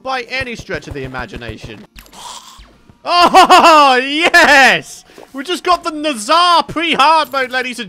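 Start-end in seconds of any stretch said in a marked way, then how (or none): 3.1-5.0 loud laughing cheer; overjoyed. Real, not acted. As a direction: crow with delight, laughing into a drawn-out yes. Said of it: "why I'm so happy about that".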